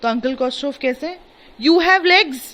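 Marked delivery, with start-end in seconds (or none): 1.6-2.4 high, loud mock shout. A teacher voicing the uncle shouting at the tired farmer. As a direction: snap it back like a hot-tempered uncle. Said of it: "my uncle Khosrow shouted।".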